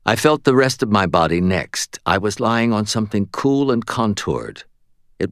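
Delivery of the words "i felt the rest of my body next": usual speaking voice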